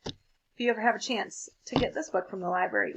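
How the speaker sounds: ordinary delivery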